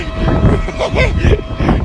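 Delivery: loud joyous laughter; loud happy laugh. Real, not acted. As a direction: laugh out in relief. Rire fort après les cris. (grand éclat de rire)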